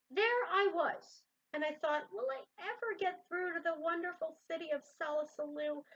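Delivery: reading a picture book aloud; lively read-aloud storytelling; story narration. A narrator's reading.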